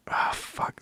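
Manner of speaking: breathy under-the-breath swear; whispered curse. Real, not acted. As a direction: mutter it to yourself, lost for the word. Gets lost mid-story.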